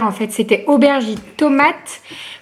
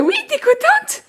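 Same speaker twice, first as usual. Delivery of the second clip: very high sing-song question. Real, not acted. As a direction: ask it like talking to a delighted infant. Asking the happy baby.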